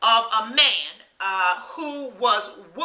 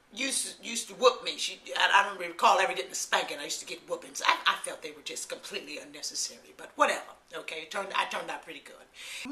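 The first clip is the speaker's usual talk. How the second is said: reflective aside; 8 semitones lower, 9 dB quieter, faster than usual. Real, not acted. Softer reflective aside about mother.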